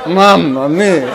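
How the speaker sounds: drawn-out laughing exclamation; laughing mamma mia. Real, not acted. Teacher laughing over the Italian family, exclaiming.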